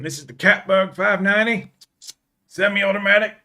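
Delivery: mock sales pitch; spoof advert announcer. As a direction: sell it like a parody commercial. Spoof advert presenting cat as gun.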